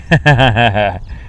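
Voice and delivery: real run of chuckles; amused laugh. Real, not acted. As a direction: laugh at a viewer's quip. Laughs at Geordie chat comment.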